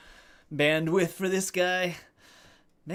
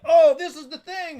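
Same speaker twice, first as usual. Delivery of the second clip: mocking newcomers; 11 semitones higher, 9 dB louder than usual. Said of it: "they're like".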